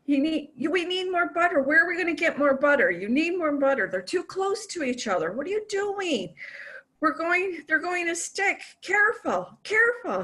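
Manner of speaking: high, fussy, panicky husband voice; frantic husband. Retold or imitated. Husband fussing at wife over frying eggs, high and panicky. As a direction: fret over the pan like a nervous husband. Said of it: "he says".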